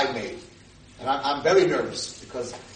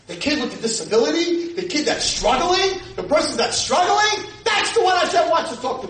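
high, loud preaching build; preaching at full force; 11 semitones higher, 5 dB louder than usual. Acted. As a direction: rise to a loud, high climax.

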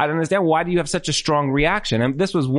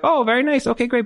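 polite brush; 9 semitones higher than usual. Retold or imitated. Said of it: "And they were like".

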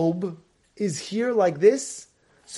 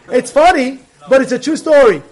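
usual speaking voice

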